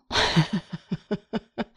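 soft giggling fit; can't stop laughing at herself; real quiet laugh; helpless laughter. Real, not acted. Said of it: "I can't stop laughing".

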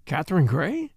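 mildly surprised, rising question; honestly surprised. A narrator's reading. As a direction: query the name in honest surprise. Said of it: "The doctor was honestly surprised".

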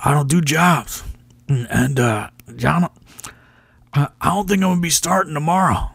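put-on high, stubborn old wrestler refusing to lose; Tommy Rich impression. Tommy Rich refusing to lose the match, high stubborn impression. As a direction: pitch it up and dig in, I don't do jobs. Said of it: "my Tommy Rich impression was pretty good".

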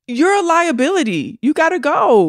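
high-pitched, animated mock dismissal; high, punchy acted verdict; emphatic dismissal. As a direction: kick them out with rising exasperation. Acts out dismissing a player.

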